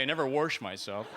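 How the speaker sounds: "warsh" with an r, wɔɹʃ; Arkansas warsh. Shown as a demonstration. Comic mocking Arkansas folks who say "warsh". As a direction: say "I never warsh" with a country r. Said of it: "In Arkansas, they say".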